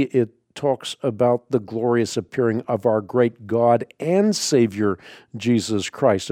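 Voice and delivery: ordinary talk, nothing marked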